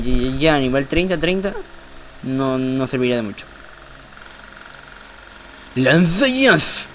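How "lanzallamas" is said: "Lanzallamas" high, slow, drawn out; anime attack call. Acted. Shouting anime attack name.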